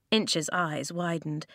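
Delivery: ordinary delivery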